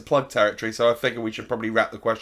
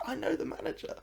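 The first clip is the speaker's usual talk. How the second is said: a whispered, higher "I know the manager"; hushed frog; 14 semitones higher, 9 dB quieter than usual. Acted. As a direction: lean in and whisper it like a secret. Frog leans in, confides.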